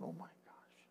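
breathless whisper to himself; whispered thought. Retold or imitated. Minister recalling a shaming remark, whispering in dismay. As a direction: breathe it out, unvoiced. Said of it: "I'm thinking".

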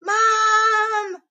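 high, drawn-out call for help; child's alarmed yell to a parent; yelling for Mom. A narrator's reading. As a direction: holler it long and high. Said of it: "I yelled".

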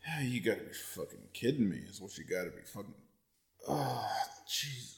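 groggy hungover mumble. Real, not acted. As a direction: hungover and quiet. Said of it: "I'm still a little hungover".

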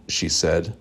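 ordinary delivery, nothing marked

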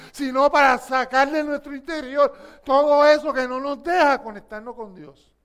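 loud preaching; impassioned preaching. Real, not acted. Preacher urging congregation, loud fervent preaching. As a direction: preach with rising fervor.

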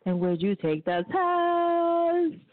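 slow, drawn-out, high-pitched topic question. Real, not acted. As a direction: stretch the question out to hook the audience. Host poses tonight's topic question.

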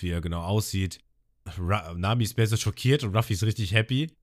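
ordinary delivery

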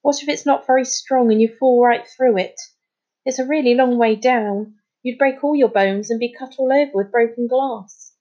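put-on mildly alarmed storybook child; anxious. A narrator's reading. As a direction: worry aloud about the danger. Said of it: "Kyla said anxiously".

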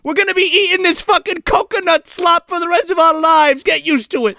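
high-pitched mock rant; pissed-off Santa. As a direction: rail bitterly in a hammed-up high voice. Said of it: "And Santa is fucking pissed".

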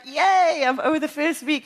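bright, loud little cheer. Retold or imitated. Telling friends she survived the first week, loud cheer. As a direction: celebrate getting through the week. Celebrating day nine.

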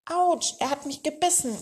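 high-pitched, restrained cry of pain; mild acted yelp. A narrator's reading. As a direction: yelp it at storybook volume. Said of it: "schrie Träumer".

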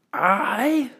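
high, outraged shriek; lady shrieking in offended pride. Acted. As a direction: explode at the insult. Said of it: "shrieked the lady".

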